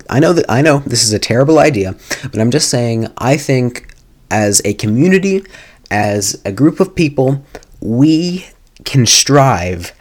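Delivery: ordinary delivery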